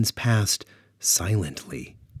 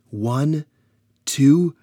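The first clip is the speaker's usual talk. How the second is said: counting up louder each number; rising countdown; 6 semitones higher, 6 dB louder, slower than usual. Acted. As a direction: build the count to a shout. Countdown building to the attack.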